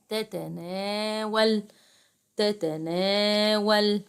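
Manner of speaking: تتناول syllable by syllable with a long aa; slow sounding-out. Shown as a demonstration. Teacher giving pupils a present-tense verb, slow sounding-out. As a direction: stretch تتناول slowly and evenly.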